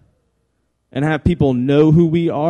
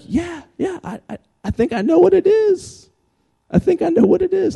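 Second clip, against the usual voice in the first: much higher, eager mock voice; eager know-it-all; 13 semitones higher than usual. Pastor answering Tom Brady's quote in an eager voice. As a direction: pipe up like a kid who knows the answer. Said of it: "And I'm over here going".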